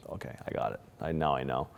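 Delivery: whispered, low and resigned, almost no voice. Retold or imitated. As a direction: breathe it out like accepting death while blacking out. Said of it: "and I'm like".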